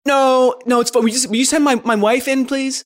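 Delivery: high, loud, stammering panic; flustered. Acted. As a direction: fumble words in a nervous rush. Flustered daughter to doctor.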